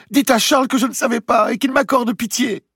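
high, loud wail for mercy; crying out, pleading. A narrator's reading. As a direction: beg loudly and desperately. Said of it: "lui cria Blanche".

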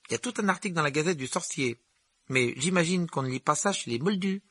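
put-on high, eager boy's voice; chatty young Ron. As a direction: play an excited young wizard sharing news. Narrator voices Ron in dialogue.

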